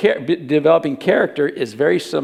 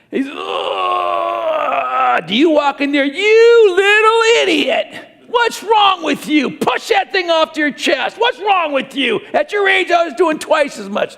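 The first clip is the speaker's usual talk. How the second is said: furious drawn-out outburst, pitched way up; angry parent yelling; 11 semitones higher, slower than usual. Acted. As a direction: yell it like an exasperated father at his son.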